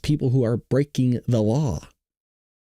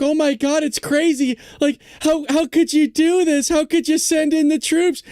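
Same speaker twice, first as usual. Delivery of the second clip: much higher, slow whiny outrage; mocking outraged liberal; 13 semitones higher, 3 dB louder than usual. Vlogger mimics Democrats complaining about troops, high whiny mock outrage. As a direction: whine it like a shocked liberal. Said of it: "Democrats like".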